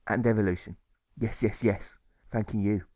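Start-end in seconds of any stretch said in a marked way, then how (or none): none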